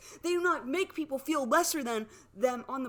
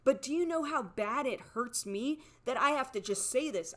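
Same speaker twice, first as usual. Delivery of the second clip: ordinary talk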